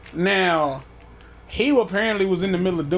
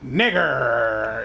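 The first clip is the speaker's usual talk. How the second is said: mock growl; 7 semitones lower than usual. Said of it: "damn near vibrated his motherfucking microphone".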